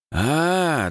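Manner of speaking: high, drawn-out dawning "ah"; realising. Acted. Boy works out the plan, realising. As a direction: let the penny drop aloud. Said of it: "догадался Димыч".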